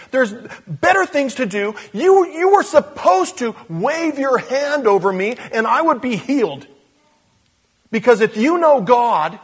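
high, loud haughty commander; indignant Naaman. Acted. Naaman complaining to Elisha's servant, indignant and haughty. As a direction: play the proud man insulted.

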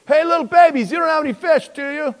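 high, loud holler throughout; calling out. Acted. Preacher playing Jesus calling to disciples in boat, high loud holler.

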